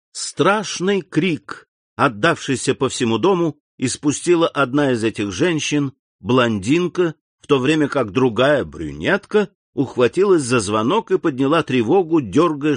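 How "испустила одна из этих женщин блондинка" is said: usual speaking voice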